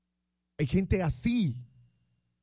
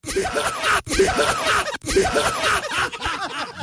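high shrieking burst of laughter; loud laugh; 5 dB louder than usual. Real, not acted. Host laughs after his rant about copy-paste messages, loud shriek. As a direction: cackle out loud.

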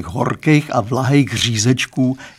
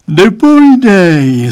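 slow, high yawning word; yawned; 7 semitones higher, 8 dB louder, slower than usual. A narrator's reading. Father yawning at the boy's age claim, said through a yawn. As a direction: stretch it out in a yawn. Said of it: "zívnul pan Stránský".

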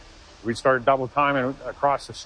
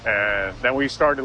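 usual speaking voice

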